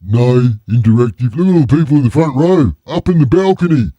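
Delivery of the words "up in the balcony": ordinary delivery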